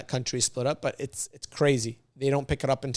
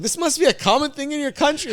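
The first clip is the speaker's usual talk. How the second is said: high-pitched, louder disbelieving quip; mock incredulity; 13 semitones higher, 5 dB louder than usual. Telling his cousin what he thinks of the advice, mock incredulous. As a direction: react as if you can't believe this.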